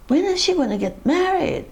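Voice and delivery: a hammed-up busybody pestering; nosy neighbours. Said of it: "everybody kept asking them".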